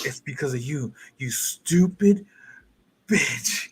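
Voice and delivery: mock furious character yelling at her; angry accusation. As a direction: spit out the blame slowly. Acted angry quote of character.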